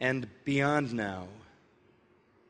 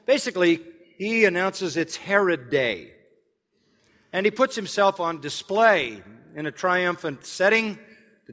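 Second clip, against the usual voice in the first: louder, higher sermon delivery; preaching at full force; 4 semitones higher, 6 dB louder than usual. Acted. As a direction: preach the story of Herod to the hall. Launches into the sermon proper.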